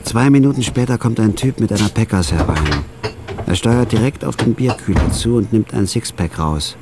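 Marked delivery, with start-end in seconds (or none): none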